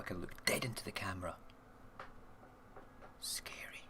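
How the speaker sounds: a hushed, playful scary whisper; spooky whisper. Pretend. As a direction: whisper it into the lens, mock creepy. Creepy look into the camera.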